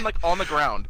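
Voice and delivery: ordinary delivery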